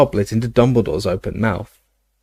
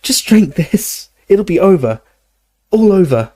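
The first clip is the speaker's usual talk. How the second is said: high, strained reassurance; urgent pleading; 9 semitones higher, 4 dB louder than usual. Acted. Harry coaxing Dumbledore through the last drink, high urgent plea. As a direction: urge him through it, voice pitched high.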